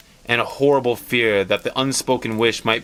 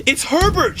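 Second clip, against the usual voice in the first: much higher, louder frantic scream; 3 dB louder than usual. Acted. Mother hearing her dead son knock, screaming. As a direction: shriek the name in wild hope. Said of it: "she screamed".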